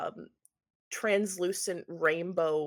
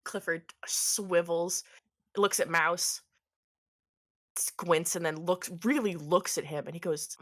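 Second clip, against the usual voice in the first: usual speaking voice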